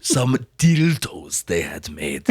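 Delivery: put-on low, slow, German-accented "Some dildos they had made."; Herzog impression. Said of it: "where he says dildos".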